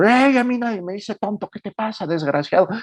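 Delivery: high-pitched mock outrage at an insult; indignant outburst. Man yelled at in the street, turning around outraged. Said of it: "Así indignadísimo".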